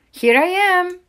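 high, loud duckling reply; excited, high-pitched call; crying out. A narrator's reading. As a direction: pipe up loudly from hiding. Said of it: "cried Widdle".

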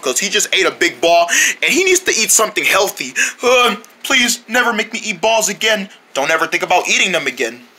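usual speaking voice

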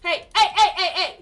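rapid-fire high, loud heys; excited shouting. Real, not acted. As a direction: holler it over and over in combat. Calling out in game fight.

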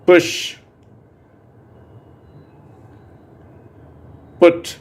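crisp single words with pauses to repeat; short u drill words. Shown as a demonstration. Teacher drills short u words with the class, loud and clear. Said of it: "வார்த்தைகளை நம்ம பயிற்சி பண்ணலாம்".

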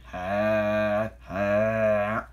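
loud, long, drawn-out haa; villainous laugh. A narrator's reading. Salty startles Thomas from hiding, booming laugh. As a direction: bellow slow mock-pirate laughs. Pirate engine scares Thomas.

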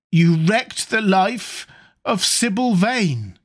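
avenging brother's grim reproach; bitter accusation. A narrator's reading. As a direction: lay the charge slowly and heavily. Avenger accuses Dorian at gunpoint.

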